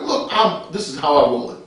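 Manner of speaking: ordinary delivery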